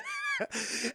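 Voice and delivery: very high squeaky laugh. Real, not acted. Cracks up at own retelling.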